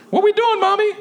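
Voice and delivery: put-on high-pitched child voice; acted high kiddie voice; little boy. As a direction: ask like a small son. Plays a child asking mother.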